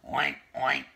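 loud piglet oinks; lightly squealed oink; squealing oinks. Acted. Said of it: "the piglet squealed".

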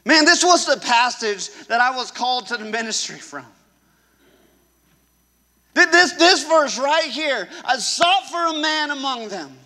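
loud rallying preacher cry; preaching at full force. Acted. Pastor rallying the congregation to refuse, full-force preaching. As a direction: call the crowd to stand firm.

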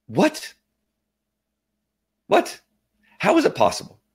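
high, incredulous questions with long pauses; amazed disbelief. Real, not acted. Amazed at no longer being pre-diabetic, incredulous. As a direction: let each question hang in astonishment. Amazed at the normal test result.

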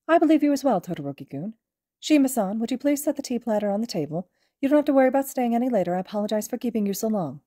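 a courteous, gently cheerful headmaster; Nezu's voice. Acted.

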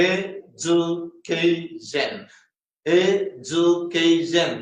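education very slowly, in four separate beats; syllable by syllable. Shown as a demonstration. Teacher showing education has four syllables, syllable by syllable. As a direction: break education into e, ju, kay, shun. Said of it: "നാല് syllable ഉണ്ട്".

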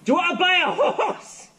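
high, hollered sales pitch; shouted punchline. Acted. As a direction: holler it as if to someone who can't hear. Punchline, shouting at banana-stuffed ears.

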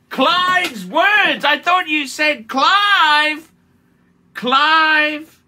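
loud, high, slow disbelieving cry of Clive; amused outraged yell; incredulous shout. Real, not acted. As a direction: shout the name in laughing disbelief. Laughing, mocking Marco's words.

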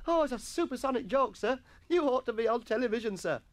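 high, hammed-up boyish praise; counterfeited glee. A narrator's reading. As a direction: flatter the master in mock hysterics. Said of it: "rocking with counterfeited glee".

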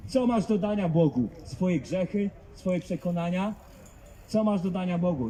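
usual speaking voice

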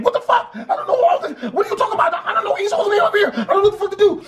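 very high, squealing mock panic; hysterical brother. Brother unable to calm down for police, hammed-up hysterical. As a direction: wail it in exaggerated helpless frenzy. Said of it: "My brother again".

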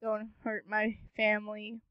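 low, soft sneering repeat; a hint of cruel mockery; mocking echo. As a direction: mimic the plea with contempt. Villain mocks the plea.

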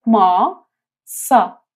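ماسا broken into ما and سا; syllable split. Shown as a demonstration. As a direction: say ما then سا apart. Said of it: "تلفظ این حرف م". (ماسا هجا به هجا)

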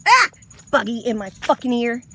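sudden loud outburst at a bug; startled loud yell. Real, not acted. Woman wading at sunset yells at a bug in her ear. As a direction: yell it as something buzzes into your ear.

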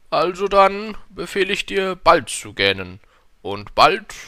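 loud, pompous king voice; befehlender König. A narrator's reading. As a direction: command like a self-important monarch. King orders, slightly annoyed. (lauter, herrischer König)

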